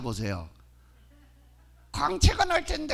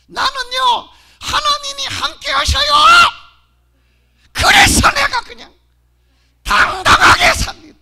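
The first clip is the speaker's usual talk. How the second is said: loud, much higher triumphant shouting; building proclamation; 9 semitones higher, 16 dB louder than usual. Acted. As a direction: preach it to the rafters. Climax of her boast.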